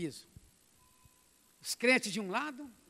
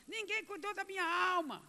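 higher, plaintive lament; a hint of a doomed soul's complaint; lost soul; 9 semitones higher than usual. Lost soul protesting at the final judgment, plaintive.

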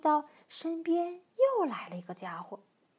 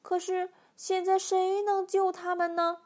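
ordinary delivery, nothing marked

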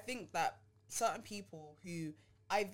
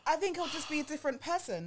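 higher, lightly put-on voice; code-switched voice; 9 semitones higher, 4 dB louder than usual. Shown as a demonstration. To friend, still demoing code-switching, higher. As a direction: keep the slight put-on voice. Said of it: "I think if I code switched".

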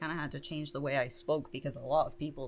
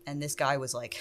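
ordinary talk, nothing marked

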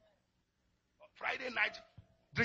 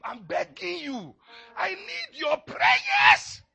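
slow, much higher, loud begging wail; pleading cry; 8 semitones higher, 14 dB louder, much slower than usual. Acted. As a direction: beg them desperately, drawn out. Pleading as Paul.

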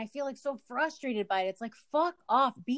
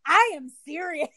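firm, raised insistence; mock serious anger; 5 semitones higher, 4 dB louder than usual. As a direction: say it like you mean it. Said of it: "and I was like".

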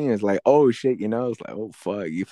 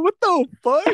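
usual speaking voice

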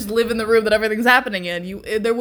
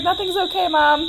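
higher, whiny dog voice to mom; hammed-up sulky puppy; voicing the dog; 8 semitones higher, 5 dB louder than usual. Dog answering his owner, high whiny voice. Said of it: "He's like".

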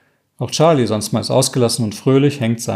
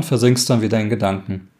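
plain reading voice

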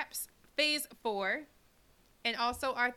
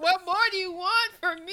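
higher, louder plaintive protest; mock exasperation; 7 semitones higher, 8 dB louder than usual. Co-host voicing the reluctant man's protest, mock exasperated. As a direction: plead it with put-upon exasperation. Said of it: "He said".

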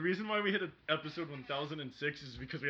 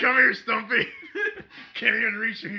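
higher, loud laughing taunt; taunting shout; 8 semitones higher, 19 dB louder than usual. Acted. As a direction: yell it to goad him. Taunting across the table.

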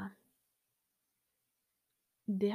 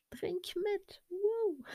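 aufgekratzt; 11 semitones higher, slower than usual. Real, not acted. Prostet den Hörern beim Trinken zu.

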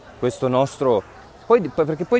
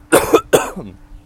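cough; 4 semitones higher, 7 dB louder than usual. Real, not acted. Coughing after the flu.

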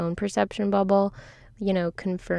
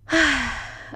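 light laugh; 7 semitones higher, 3 dB louder than usual. Real, not acted. Laugh after joke about acoustics.

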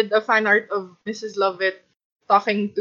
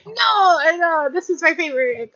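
higher-pitched mock protest; imitating a protesting fan; 8 semitones higher than usual. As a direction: play an indignant fan, pitched up. Imagined listeners protesting about favorites.

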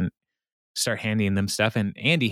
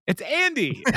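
excited reveal; 13 semitones higher than usual. Retold or imitated. Andy reveals himself on radio.

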